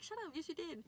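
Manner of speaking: high, mock-insistent comeback; playful protest. Retold argument with the boys.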